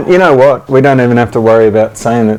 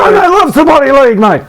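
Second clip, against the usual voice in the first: much higher ocker mock voice; mock ScoMo; 13 semitones higher than usual. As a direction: ham up a bogan Aussie bloke. Mocking ScoMo as footy fan.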